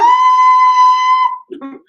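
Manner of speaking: high piercing burst of laughter; loud squealing laugh. Real, not acted.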